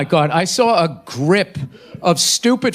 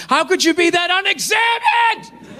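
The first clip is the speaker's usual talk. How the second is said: high, shouted mock scream across the street; 11 semitones higher than usual. Screaming across the street at the soccer moms, shouted. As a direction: yell it across the street in outrage. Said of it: "across the street screaming".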